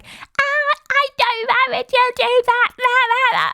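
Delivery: put-on very high, squeaky whining childish voice; mocking Harriet's voice. A vlogger mocking an islander's high voice, squeaky and whiny. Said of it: "She sounds too, like".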